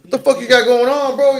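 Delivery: loud, high retold confrontation; indignant challenge.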